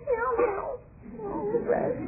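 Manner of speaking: very high-pitched little character voice; imitated puppet voices. Recalling the TV host doing his wonderful voices, imitated puppet voices. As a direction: mimic a children's puppeteer, way up high. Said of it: "doing all of his wonderful voices".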